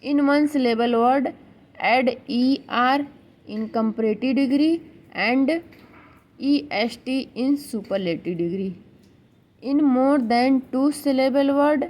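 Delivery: very slow, word by word, as if for notes; slow dictation. Shown as a demonstration. Teacher dictating a rule to students, very slow. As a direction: spell the rule out slowly for writing down.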